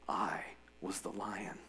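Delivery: soft whisper, the lion's secret; whispered reveal. A narrator's reading. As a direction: breathe it as a confession. Aslan reveals himself.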